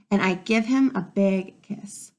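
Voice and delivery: ordinary delivery, nothing marked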